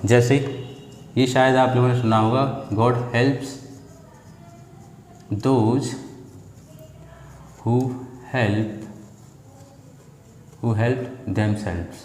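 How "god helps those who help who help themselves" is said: word by word, with long pauses; slow dictation, as if writing it out; dyktowanie przy pisaniu. Shown as a demonstration. Writing out an example sentence.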